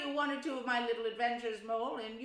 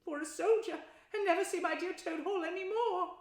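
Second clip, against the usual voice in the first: sobbing; 9 semitones higher, 7 dB louder than usual. A narrator's reading. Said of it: "sobbed the Toad".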